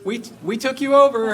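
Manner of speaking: high, loud boastful crow of a conqueror; hammed-up gloat, pitched well up and loud; mock proud announcement. Retelling War and Peace, Napoleon's army gloats to Moscow, mock proud and high.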